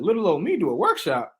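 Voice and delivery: who, me?; stunned mock-modest question; incredulous surprise. Surprised at workshop offer.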